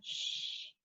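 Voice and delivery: slow soft shh like hushing a room; a drawn-out shh. Shown as a demonstration. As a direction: shh as if telling kids to hush. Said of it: "telling everybody to hush".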